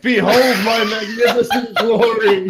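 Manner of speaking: grandly declaiming a boast, laughing; puffed-up hero proclaiming glory; pompous vain hero. Pretend. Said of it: "you're so tired that you're like".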